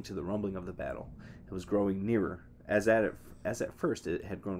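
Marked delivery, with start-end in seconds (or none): none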